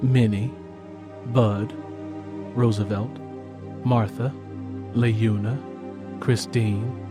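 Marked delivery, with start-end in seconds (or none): none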